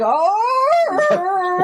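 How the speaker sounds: high, loud mock creature howl; hammed-up Bigfoot call into the night; imitated Bigfoot call. [name] calling out to Harry in the street, mock Bigfoot howl. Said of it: "a Bigfoot call".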